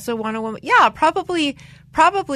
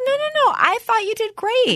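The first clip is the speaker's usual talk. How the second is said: high-pitched mock protest; imitating a friend rushing to reassure; over-eager reassurance; 14 semitones higher than usual. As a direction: insist sweetly in a much higher voice.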